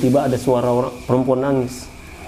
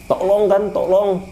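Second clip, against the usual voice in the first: retold cry for help; 6 semitones higher, slower than usual. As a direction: imitate calling out for help without full volume. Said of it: "saya ini teriak di situ". (tiruan teriakan minta tolong)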